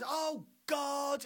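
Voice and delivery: high, loud exasperated groan; mock exasperated sigh. Person on earth groaning at God. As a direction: whine at the ceiling. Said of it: "someone down on earth goes".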